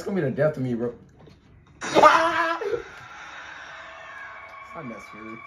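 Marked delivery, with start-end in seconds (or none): 2.0-4.7 breathless squeal. Real, not acted. After escaping monster.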